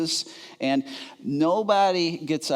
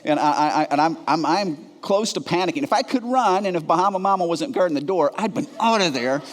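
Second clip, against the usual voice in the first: ordinary delivery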